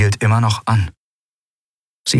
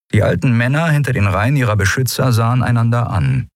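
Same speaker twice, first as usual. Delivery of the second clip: usual speaking voice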